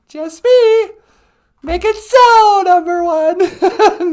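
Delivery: high, loud, drawn-out mock order; acted sci-fi command. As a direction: call out a captain's order, hammed up. Acted sci-fi command over call.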